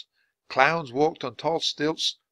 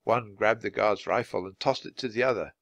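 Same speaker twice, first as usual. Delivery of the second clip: usual speaking voice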